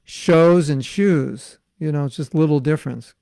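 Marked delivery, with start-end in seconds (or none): 0.0-1.6 "shows" and "shoes" set apart, long and loud; contrasting two vowels. Shown as a demonstration. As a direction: stretch "shows" against "shoes", oh then oo. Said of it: "it's like saying".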